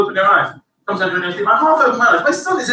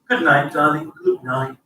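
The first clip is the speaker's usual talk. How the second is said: a hint of a low, doting goodnight; lower, tender goodnight, half-mocking; lightly voiced loving spouse; low sweet goodnight; 10 semitones lower than usual. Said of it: "most nights say".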